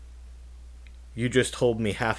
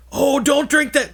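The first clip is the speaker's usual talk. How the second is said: put-on high, louder old-man voice; little old man; 9 dB louder than usual. Retelling Twin Peaks, imitating the mustached old guy, high and loud. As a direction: squeak it out like a fussy old man. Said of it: "the little old guy with the mustache is like".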